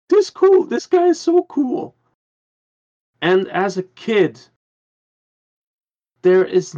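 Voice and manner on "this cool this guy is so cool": put-on high-pitched, excited quote of a kid. Remembering his reaction to the boy behind the fence, excited. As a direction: pitch it way up, like a thrilled child.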